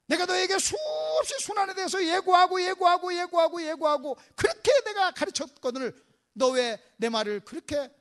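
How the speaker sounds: exasperated scolding building to loud, repeated insistence; staged master reproaching his pupils; acted rebuke. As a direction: hammer the reminder, then ease off. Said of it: "이렇게 책망할 수도 있었어요".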